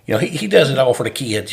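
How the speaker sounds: lightly voiced overzealous Alabama coach; Alabama coach accent. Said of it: "I can fall into that accent with him".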